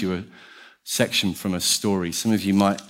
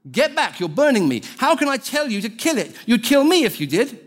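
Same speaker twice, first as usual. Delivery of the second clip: high-pitched, alarmed ghost voice; panicking ghost; 13 semitones higher than usual. Acted. Ghost warding off the burning angel, panicked.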